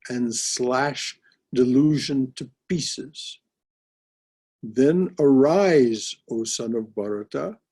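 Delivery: slow, solemn declaiming of verse; scripture recitation. Acted. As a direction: proclaim it like holy scripture. Reciting Gita verses to class.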